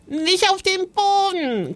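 very high, drawn-out squeal of protest; screech. A narrator's reading. Little ghost protesting to his mother, a high drawn-out screech. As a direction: squeal in horror without much extra volume. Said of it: "kreischte Joris".

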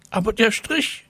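high-pitched schoolboy whine; whining. A narrator's reading. Mirko protesting to the teacher, whining. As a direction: wail the objection in a high child voice. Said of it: "jammerte Mirko".

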